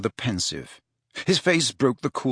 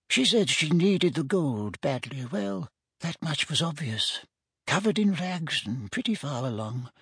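put-on higher, softer old man's voice; crafty old shopkeeper; 6 semitones higher, 4 dB quieter than usual. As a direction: reminisce quietly like a little old dealer. Memory figure of little old man.

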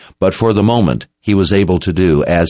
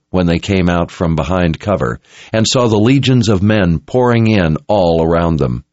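ordinary delivery, nothing marked

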